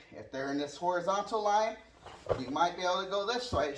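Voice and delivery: normal talking voice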